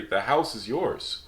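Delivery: ordinary delivery, nothing marked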